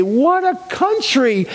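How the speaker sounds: amused father marvelling. Retold or imitated. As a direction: savor it like a delighted immigrant.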